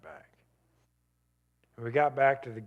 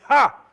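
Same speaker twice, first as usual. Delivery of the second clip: sharp bitter laugh; 12 semitones higher, 16 dB louder than usual. Real, not acted.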